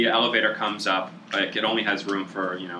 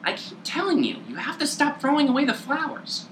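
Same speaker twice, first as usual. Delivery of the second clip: the gnome again, pitched way up; fussy little gnome, scolding gently; high gnome voice. Acted. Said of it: "hands her another flower and says".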